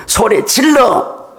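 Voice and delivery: slow, high yell; drawn-out call to shout; demo yell. Shown as a demonstration. Pastor showing the congregation how to yell. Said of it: "급할 때는 소리 질러야 돼요".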